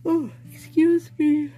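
high, stretched words through a real yawn. Real, not acted. Streamer excusing a yawn to viewers, yawning. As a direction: talk right through a big yawn. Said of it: "if I made you yawn".

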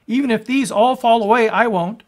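high, loud insistence; boastful vow. Retold or imitated. Preacher recalling Peter's proud boast to Jesus, loudly. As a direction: claim it proudly.

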